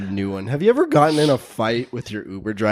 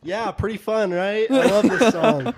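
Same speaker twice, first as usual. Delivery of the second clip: mock oblivious; 9 semitones higher than usual. Mocking clueless club chat.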